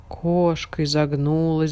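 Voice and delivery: plain reading voice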